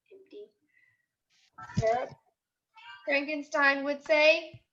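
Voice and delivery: put-on lively storybook voice for young kids; picture book read-aloud. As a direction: read it like a class story time. Teacher reads picture book aloud.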